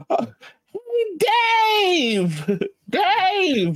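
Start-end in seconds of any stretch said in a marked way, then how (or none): 1.2-3.8 very high calling voice; zing voice. Pretend. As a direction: call his name way up high. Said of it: "you have to do it in a zing voice".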